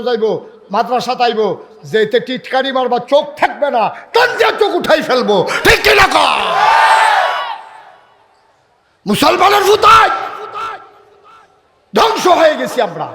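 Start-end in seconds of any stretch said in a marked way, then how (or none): 4.1-13.1 high, loud shouting sermon warning; loud, high-pitched preaching at full force; fiery preaching. Acted. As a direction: thunder the warning at the youths.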